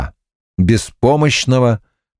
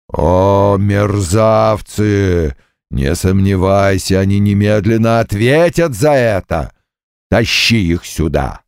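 demon bull voice; 2 semitones lower than usual. Acted.

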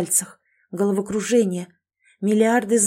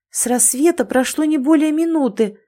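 higher, louder astonished exclamation; astonished gasp; 6 semitones higher, 4 dB louder than usual. Acted. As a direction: gasp it out in amazement. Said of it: "ахнула изумлённая Катрина".

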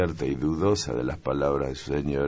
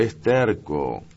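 Amalia exclaims, slow and a bit higher; exclaiming; 5 semitones higher, 5 dB louder, much slower than usual. A narrator's reading. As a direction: mutter it firmly, half to herself. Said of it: "exclamó Amalia". (exclamación lenta y algo aguda)

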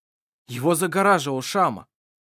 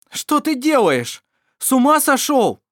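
high, loud, alarmed cry; exclaiming; 12 semitones higher, 8 dB louder than usual. Acted. Vassar yelling at Mar for dropping the torch, high alarmed shout. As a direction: shout it in disbelief. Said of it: "воскликнул Вассар".

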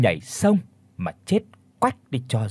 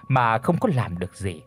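ordinary delivery, nothing marked

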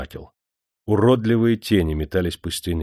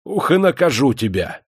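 higher, louder angry threat; 7 semitones higher, 4 dB louder than usual. A narrator's reading. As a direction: promise punishment furiously. Master threatens prisoner.

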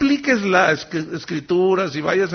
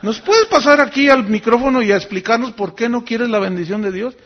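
higher, mocking sing-song to the crowd; sneering preacher calls him out; sarcastic mockery; 5 semitones higher, 4 dB louder than usual. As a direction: ridicule the pastor publicly. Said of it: "así burlándose sarcásticamente".

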